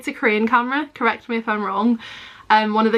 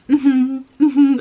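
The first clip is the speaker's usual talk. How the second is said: higher, louder closed-mouth agreeing hums; awkward mm-hmms, exaggerated; mock nervous self; 4 semitones higher, 5 dB louder than usual. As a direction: lightly send up your own tense nodding along. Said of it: "bent over with my shoulders up".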